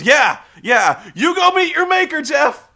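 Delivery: high, loud hammed-up Ric Flair; mock Ric Flair shout. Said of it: "And Ric Flair goes".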